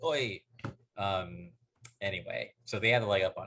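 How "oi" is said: higher, hammed-up British "oi"; mock British accent. Joking about British accents on TV, mock British accent. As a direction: call "oi" like a cartoon Brit. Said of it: "I actually like hearing those British accents".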